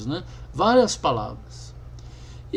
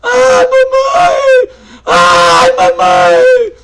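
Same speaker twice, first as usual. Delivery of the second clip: much higher, loud, drawn-out wail; wailing sobs; 12 dB louder, much slower than usual. Retold or imitated. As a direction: howl for your mother in grief. Woman embracing stand-in mother, wailing.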